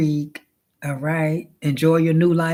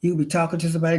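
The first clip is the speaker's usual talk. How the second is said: ordinary talk, nothing marked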